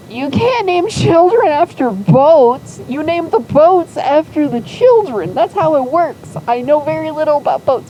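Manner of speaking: high, loud, indignant protest; exasperated scolding. Retold or imitated. Zero objecting to naming children after boats, exasperated scolding. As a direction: lay down the rule, appalled and emphatic.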